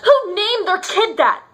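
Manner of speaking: high, indignant mock-incredulous rant; scornful outburst. Jilted ex mocking the name Jessica, indignant outburst.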